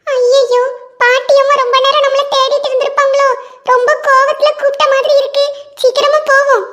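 put-on high child's voice, anxious hurry; a boy fretting he is late; worried little boy. Ringu fears grandma is angry, high and anxious.

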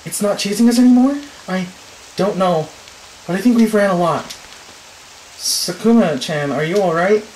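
high, loud, out of breath; breathless and keyed up. Real, not acted. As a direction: gasp it after the chase. Breathless after running.

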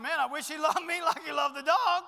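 put-on 1 jealous kid. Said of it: "The kids are watching".

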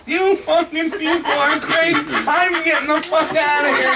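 slow, high, screamed outrage; freaking out. Acted. Guest screaming at hotel staff after the enema, freaking out. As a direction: stretch the words out in a furious yell. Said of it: "the guy's fucking freaking".

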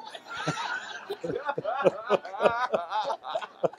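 long high laugh after the punchline. Real, not acted. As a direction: let a long laugh roll out.

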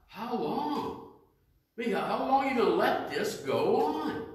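high, pleading plea to God; voicing the martyrs' pleading question; pleading cry.

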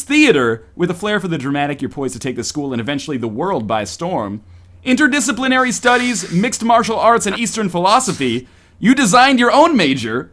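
dramatic game-host read; game narration. Acted. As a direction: present each option with showy flourish.

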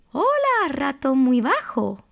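high sing-song mouse greeting; ratón muy alto. Acted. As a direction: call out hello in a high little story voice. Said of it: "Ratón muy alto decía". (saludo agudo de ratón de cuento)

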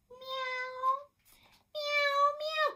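very high, drawn-out mewing; cat meow. A narrator's reading. Teacher showing children what a cat says, high meowing. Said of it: "cried the cat".

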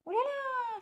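very high oh là là; a narrow escape cry; little bird startled. A narrator's reading. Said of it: "fit César".

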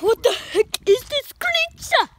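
loud, slow marvelling at the creature; excited explorer. Acted. Young explorer showing viewers a creature, loud and slow. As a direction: call it out in slow wonder.

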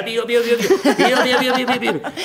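high, laughing pio pio of a mother hen; hen clucking call. Acted. Old man imitating a hen calling chicks, laughing.

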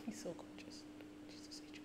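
soft unvoiced whisper; awed whisper. Real, not acted. Fan whispering over the singer's looks. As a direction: breathe it out in awe.

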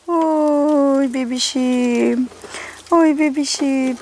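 long drawn-out doting coo. Real, not acted. Owner fussing over a pet, slow tender cooing.

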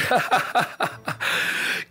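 high, helpless run of laughter; real, drawn-out laugh; laughing fit. Real, not acted. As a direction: burst out laughing at your friend. Laughs at how Scully looks.

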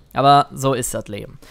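muttered to himself, resigned; resigned sigh. Real, not acted. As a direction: let it sink in low with a shrug. Repeats it low and resigned.

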